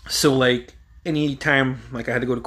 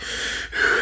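sharp blown-out breath; mimed panicky exhale; imitated hyperventilating. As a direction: blow out air as if panicking. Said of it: "I started, like, hyperventilating".

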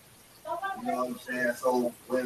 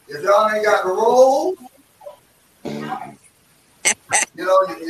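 loud hype shout-out; 4 semitones higher, 6 dB louder than usual. Real, not acted. Hyped shout-out to the ladies.

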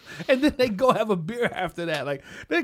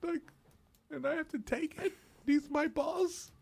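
high, soft, halting protest; mock restrained indignation; 6 semitones higher, 4 dB quieter than usual. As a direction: swallow the rage and answer very politely. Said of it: "I'd be like".

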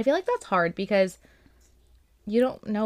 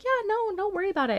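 much higher, sweet and eager; sweet lady voice; 13 semitones higher than usual. Retold or imitated. Delivery driver reassuring the customer, in a high sweet voice. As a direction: play the kindest delivery driver. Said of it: "She's like".

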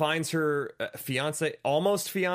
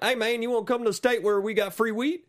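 exaggerated Southern twang, higher voice; mock Southern accent; 8 semitones higher than usual. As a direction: put on a cartoon Southern accent, pitched up. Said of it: "they don't have southern accents in Colorado".

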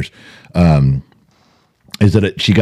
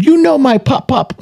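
put-on defensive little girl. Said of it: "Got a little defensive".

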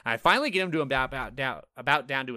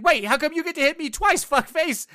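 high, loud mock outrage at the game; indignant yelling at the screen; mock angry yell; 9 dB louder than usual. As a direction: shriek it like a cheated gamer. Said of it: "I was getting so mad".

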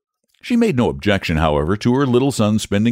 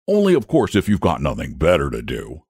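ordinary delivery, nothing marked

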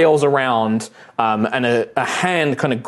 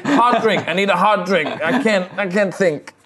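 put-on hungover drunk; 6 semitones higher than usual. Drunk voiced by GM.

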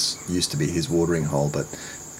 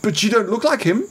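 mocking high, loud disbelief; the doctor in high, loud disbelief; bewildered high voice; 5 dB louder than usual. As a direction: squeak it out, baffled and louder. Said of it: "he was going".

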